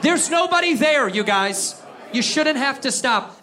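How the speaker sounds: ordinary talk, nothing marked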